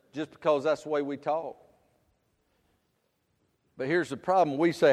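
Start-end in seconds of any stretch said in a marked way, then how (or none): none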